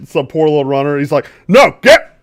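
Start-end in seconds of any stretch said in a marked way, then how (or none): 1.5-2.2 sharp, loud and high; yelling. Acted. Quartermaster cursing out a runner, yelling. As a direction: bark it at the poor runner.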